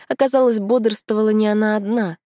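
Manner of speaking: ordinary delivery, nothing marked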